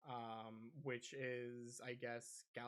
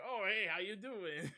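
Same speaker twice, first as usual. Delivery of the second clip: high, louder put-on Hondo greeting; 7 semitones higher, 6 dB louder than usual. Imitating Hondo greeting the player in a high accent. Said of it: "he's like".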